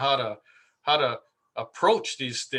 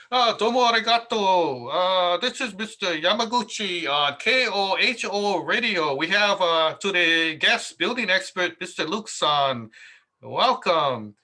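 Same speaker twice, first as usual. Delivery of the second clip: higher, louder imitation of a Japanese radio host; mock Japanese accent; 5 semitones higher, 3 dB louder than usual. Host introducing the guest on live radio, mock Japanese accent. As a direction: ham up a Japanese DJ's on-air intro.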